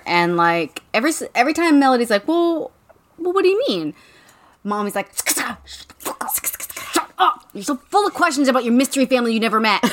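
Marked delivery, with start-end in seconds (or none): 2.3-3.9 high-pitched puzzled child impression; questioning kid. Imitating Melody questioning Mommy, high puzzled voice. 5.3-9.8 mock-furious hissing whisper, breaking into laughter; hissed scolding. Imitating Mommy silencing Melody's questions, hissed mock fury.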